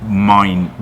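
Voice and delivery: normal talking voice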